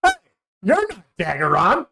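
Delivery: high, squeaky little cat voice, let down; little genie voice. Acted. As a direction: peek out and find the wrong person. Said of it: "and it goes".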